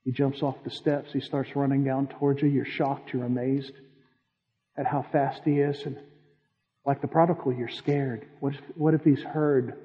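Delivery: soft, hushed storytelling to the congregation; guided imagery narration. Acted. Preacher guiding listeners through the prodigal's return, hushed. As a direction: paint the scene quietly, drawing them in.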